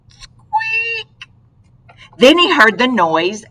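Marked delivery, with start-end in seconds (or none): 0.1-1.3 tiny high mouse squeak. Acted. The mystery noise in Owl's house, high squeak.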